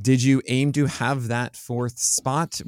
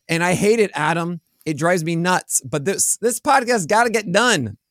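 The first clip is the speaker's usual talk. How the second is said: sore mouth and throat, thick speech; gauze in mouth; 6 semitones higher, 4 dB louder than usual. Real, not acted. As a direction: talk like gauze fills your mouth. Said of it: "talk like I have gauze in my mouth".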